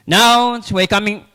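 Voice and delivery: loud announcer call; loud burst into the mic; too loud. Real, not acted. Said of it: "Sorry, too loud".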